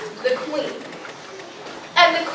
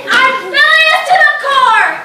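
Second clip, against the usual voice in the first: louder, higher hammed-up rage; mock furious; 7 semitones higher, 7 dB louder than usual. Belinda Blue in a tantrum, mock furious. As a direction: play the tantrum big. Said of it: "Belinda Blue was furious".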